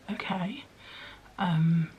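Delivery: stunned, breathless whisper; shocked whisper. Retold or imitated. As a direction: mimic a partner too shocked to speak. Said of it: "You were just like".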